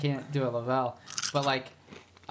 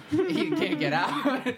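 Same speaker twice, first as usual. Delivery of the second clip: laughing; 11 semitones higher, slower than usual. Real, not acted. Laughing telling.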